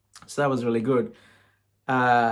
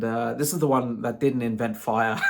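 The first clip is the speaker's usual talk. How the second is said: ordinary delivery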